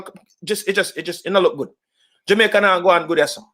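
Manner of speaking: ordinary talk, nothing marked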